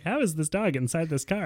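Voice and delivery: high-pitched baffled panic; retold freak-out. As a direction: act out freaking out in bewilderment. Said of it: "and I'm freaking out".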